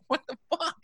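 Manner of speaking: high, breathy-free shocked squeak of disbelief; leaping up high in stunned disbelief; stunned what the fuck. Retold or imitated. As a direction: let it jump up high in disbelief. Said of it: "I was like".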